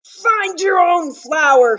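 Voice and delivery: high, loud, possessive shout. Acted. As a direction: yell like a greedy bee guarding its flower. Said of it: "he shouted".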